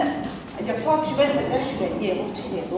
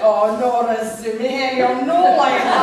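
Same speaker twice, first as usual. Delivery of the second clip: put-on Coatbridge accent; 2 semitones higher, 7 dB louder, slower than usual. Caller giving her name to reception, loud Coatbridge accent. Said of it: "in her best Coatbridge accent".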